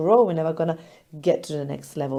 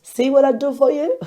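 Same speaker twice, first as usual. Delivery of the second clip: high, loud teasing boast; playful boast; 10 semitones higher, 7 dB louder than usual. Real, not acted. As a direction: tease them, proud and bright.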